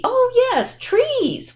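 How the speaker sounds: put-on sudden high-pitched oh yes for the kids; excited remembering. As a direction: act like you just remembered.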